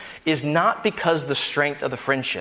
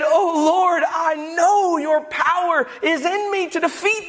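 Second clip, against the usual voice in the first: fervent mock prayer; 10 semitones higher than usual. Believer crying out to God against sin, fervent shouted prayer. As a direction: plead with God like a believer who truly trusts. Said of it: "we said".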